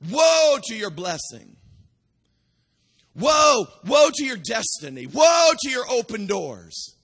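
loud, slow shouted woes, each woe hammered; Jude's woes. Retold or imitated. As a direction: thunder each woe like a prophet pronouncing doom. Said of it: "literally Jude is saying".

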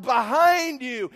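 slow, loud shouted warning; loud yell. Retold or imitated. Preacher yelling at the monster from the second row, loud and slow. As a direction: yell it out across the theatre. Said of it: "I yelled out".

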